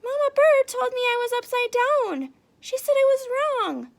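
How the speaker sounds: high, loud puzzled bat; confused young bat. Acted. Stellaluna confused, high and loud. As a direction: plead your confusion like a little bat.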